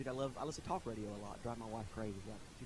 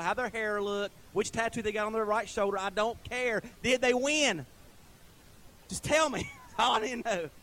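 rising, louder and higher preacher's build; preaching at full force; 6 semitones higher, 9 dB louder than usual. Acted. Pastor working up the congregation about men, building preaching. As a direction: preach it harder line by line to the congregation.

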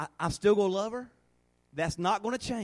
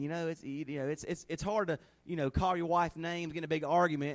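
ordinary talk, nothing marked

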